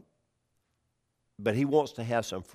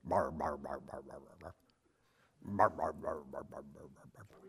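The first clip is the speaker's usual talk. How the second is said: soft mumbling bar bar babble, much quieter; mock foreign babble; 8 semitones lower, 11 dB quieter than usual. Preacher imitates barbarian speech as quiet babble. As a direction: mimic gibberish as Greeks heard foreigners. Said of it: "and they sounded like this".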